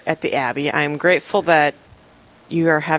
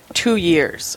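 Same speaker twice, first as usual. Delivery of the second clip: ordinary talk, nothing marked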